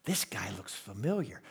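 inner thought. Retold or imitated. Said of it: "I'm thinking".

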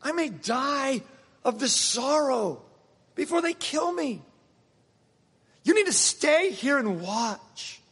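high, slow, strained cry of sorrow; acted anguish. Jesus to his disciples in Gethsemane, high slow acted anguish. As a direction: draw out each phrase high, with pauses between.

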